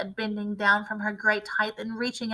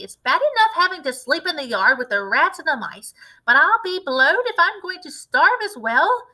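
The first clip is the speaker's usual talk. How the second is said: put-on higher, louder cranky old grandma; shouting grandma; 5 semitones higher, 5 dB louder than usual. A narrator's reading. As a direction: scold them like a bossy old woman. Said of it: "and she shouted".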